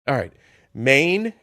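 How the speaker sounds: usual speaking voice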